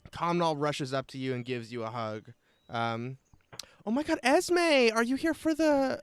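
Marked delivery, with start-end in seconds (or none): 3.8-6.0 high, eager acted druid woman; excited reunion. Druid woman hugging Esme and asking, high excited voice. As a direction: gush the question while rushing into a hug.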